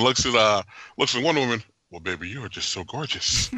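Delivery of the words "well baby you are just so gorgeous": smooth flirt. Retold or imitated. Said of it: "his smooth on".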